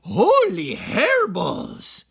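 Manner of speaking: high, loud, slow cry; shocked exclamation. Acted. Skippy seeing his huge head in the mirror, loud and high. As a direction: gasp at his own reflection. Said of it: "exclaimed Skippy John Jones".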